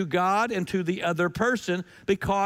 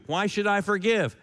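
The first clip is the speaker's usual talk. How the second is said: usual speaking voice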